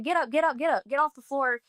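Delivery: lightly acted insistent urging; retold urgent command. Relatives standing over her urge her off the floor, mildly acted urgency.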